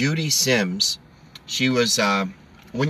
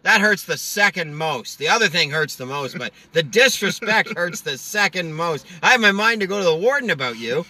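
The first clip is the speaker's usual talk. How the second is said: Norm voice; 6 semitones higher than usual. Retold or imitated. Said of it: "He goes".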